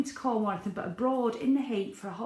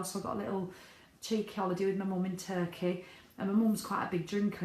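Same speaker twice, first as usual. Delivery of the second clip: usual speaking voice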